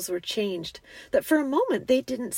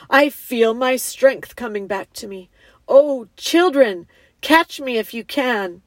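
loud, grand and playful; exultant lion; 2 semitones higher, 9 dB louder than usual. A narrator's reading. Lion invites the children to chase him, exultant. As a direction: proclaim it with gathering joy.

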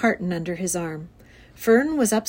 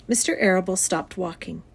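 ordinary delivery, nothing marked

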